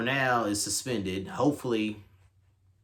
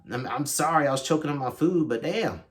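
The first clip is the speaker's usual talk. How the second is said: normal talking voice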